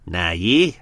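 a light Australian lilt, rising on yeah; mock Australian accent. Host mimics the runaway husband in a light Australian accent. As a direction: tease him with a hint of Australian twang. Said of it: "what he would have spent the rest of his life doing, going".

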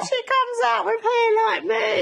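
high, loud burst of hilarity; amused shriek. Real, not acted. Mum teasing daughter about the baby's hair, shrieking with amusement.

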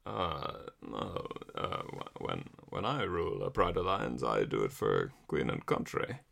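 very low, soft suave English lion; Roger Moore impression. Host voices Roger Moore as Mufasa, low and soft. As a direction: purr it like a dry, understated Bond. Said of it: "[name]'s impression of Roger Moore".

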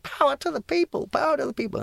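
high-pitched protest chant; retold megaphone shout. Young protester shouting into a megaphone at the march, high chant. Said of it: "I was handed the megaphone and I shouted".

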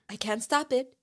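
playful film-line impression; mock helpless dancer. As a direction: lightly imitate a man carried away by dancing. Quotes Albert dancing uncontrollably.

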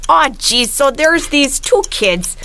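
put-on light Minnesota Fargo accent, slowed in places; put-on Fargo Midwest accent. Host telling the plot for the game in a Fargo accent. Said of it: "lean really hard into the Midwest accent".

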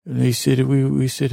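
ordinary delivery

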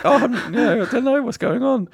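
high, clueless act; playing dumb. Target still playing along with the scammer, high mock innocence. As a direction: feign innocence in a high-pitched voice. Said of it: "still playing along like".

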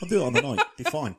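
loud, high cocky boast; cocky confidence. Pretend. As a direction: brag it loudly. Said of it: "I was like".

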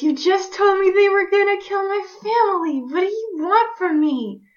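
tense, strained, high whisper-shout; whisper yelling. Acted. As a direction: yell it under your breath, desperate. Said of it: "whisper shouting this".

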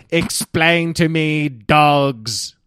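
slow, grand alien demand; lightly voiced alien, drawn out. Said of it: "I'll play the role of aliens".

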